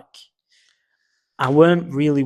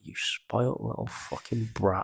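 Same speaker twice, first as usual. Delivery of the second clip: much softer, under the breath; muttered insult; 10 dB quieter than usual. Retold or imitated. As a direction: grumble it to yourself at the screen. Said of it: "and I'm just like".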